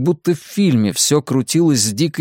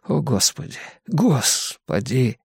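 softer gasped plea, slowing down; hushed panic; 4 dB quieter, slower than usual. A narrator's reading. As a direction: plead under your breath in fear. Victim's muffled panicked inner cry. (тихая испуганная мольба)

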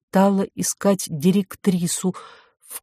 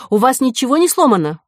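quick, high urgent question; calling out; 7 semitones higher, 4 dB louder, faster than usual. A narrator's reading. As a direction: fire the question off in a raised voice. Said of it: "крикнула Ко". (торопливый вопрос на повышенных тонах)

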